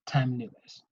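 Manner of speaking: breathy whisper on the new term; whispered key term. Real, not acted. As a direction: let the word drop to a whisper. Lecturer names the key term.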